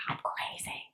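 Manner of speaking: unvoiced whisper to herself; whispered self-doubt; whispered aside. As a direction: breathe it out under your breath.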